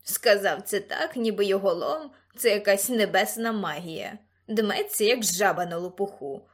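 loud, scornful creature voice; loud mocking creature commentary; Ксимеріус scoffing. A narrator's reading. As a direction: sneer at the puffed-up man.

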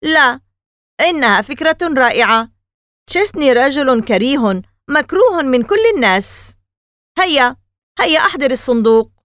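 loud, high, pushy insistence; insisting. A narrator's reading. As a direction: push him hard until he gives in. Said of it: "أصر ألان".

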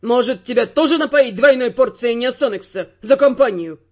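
low, loud male voice, sneering fury; furious sarcasm. Acted. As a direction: spit the offer at him. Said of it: "Тео был вне себя".

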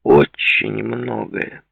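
"Очень многое" drawn out slowly with threat; slow, weighted menace on "Очень многое"; menacing. A narrator's reading. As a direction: repeat it as a slow warning. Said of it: "повторил с угрозой в голосе".

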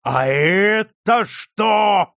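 loud, very drawn-out accusing bellow; sudden roar. A narrator's reading. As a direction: roar it slowly in triumph. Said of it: "вдруг заревел Свищев".

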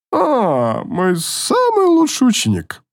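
tipsy, high and drawn out; a drunk teacher gushing; drunk elf voice. Acted. Drunk instructor greets student.

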